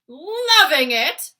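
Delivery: slow, very loud incredulous giant cry; outraged cry. A narrator's reading. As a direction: hurl the words back in disbelief. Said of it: "cried the blood bottler".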